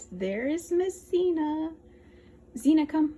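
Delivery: high, loud dog call; talking to a dog. Real, not acted. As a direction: coax the dog in a sing-song. Calling and coaxing the dog.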